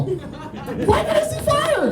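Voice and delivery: very high, drawn-out mock panic; freaking out. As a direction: wail it slowly at the top of the voice. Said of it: "You gotta stop freaking out".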